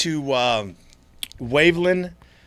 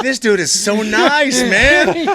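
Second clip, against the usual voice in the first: higher, slow, playfully gullible; mock naive; 12 semitones higher, 8 dB louder, much slower than usual. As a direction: sell the fake innocence slowly and loud. Mock naive thought about stranger.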